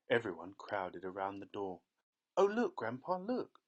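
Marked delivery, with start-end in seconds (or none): 2.4-3.6 put-on high, eager child calling out; rising, thrilled little boy's call; excited cry. A narrator's reading. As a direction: point it out in wonder, voice soaring. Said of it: "cried Charlie".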